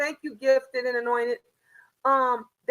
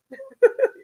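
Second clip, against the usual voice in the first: burst of laughter; 11 semitones higher, 5 dB louder than usual. Real, not acted. Laughing at her own story.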